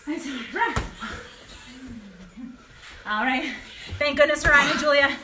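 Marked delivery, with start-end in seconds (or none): none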